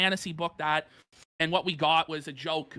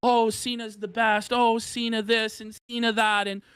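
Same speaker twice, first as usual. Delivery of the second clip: higher, slower sneering fan voice; mocking fans; 5 semitones higher than usual. Podcaster mocking nostalgic Cena fans, sarcastic and higher. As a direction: drag it out as a sarcastic mockery. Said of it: "this revisionist history about Cena".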